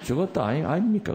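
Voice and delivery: high, sing-song Gyeongsang accent; demonstrated Gyeongsang question; Gyeongsang dialect. Shown as a demonstration. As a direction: swing the pitch up like a Gyeongsang speaker. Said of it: "경상도 말로 뭐라 그럽니까?".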